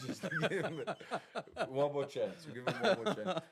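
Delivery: talking through laughter; amused, laughing plea. Real, not acted. Banter over muting co-host.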